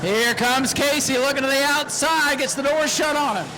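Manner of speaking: fast, high, rising race commentary that swells to shouts at the finish; race call. Real, not acted. Track announcer calling the last lap to the crowd, excited shouting.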